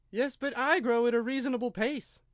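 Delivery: a small creature griping; complaining Dormouse. A narrator's reading.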